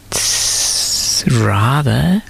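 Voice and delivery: hissing, slow, breathy whisper; snake's whisper. A narrator's reading. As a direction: lean in and hiss the secret. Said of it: "whispered the snake".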